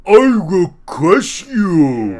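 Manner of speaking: slow, high, hammed-up brute voice; overacted villain threat; barbarian. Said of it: "impression of him".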